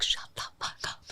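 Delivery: a furious whisper to hush him while on the phone; hissed whisper. Retold or imitated. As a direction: whisper it fiercely, unvoiced. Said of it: "I was like".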